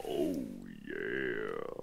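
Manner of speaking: very low, quiet, drawn-out mock voice; deep put-on voice. Reading out a viewer's chat reply, deep put-on voice. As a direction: drop right down and stretch it out softly. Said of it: "And then Hoppin says".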